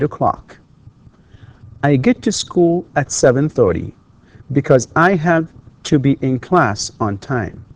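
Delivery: measured classroom reading with pauses between phrases; careful teacher's reading, each sentence set apart; model read-aloud. Acted. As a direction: read it out clearly for language learners, phrase by phrase. Reads lesson passage aloud as model.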